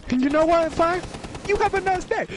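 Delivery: yelling across the room. Real, not acted. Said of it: "Just yelling across the room".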